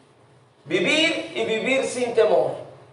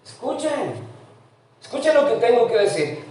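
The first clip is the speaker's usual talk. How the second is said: higher, begging "Escuchen" call; pleading wisdom; 3 semitones higher, 3 dB quieter than usual. Acted. Wisdom personified begging the crowd to listen, pleading. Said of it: "La sabiduría está rogando y dice".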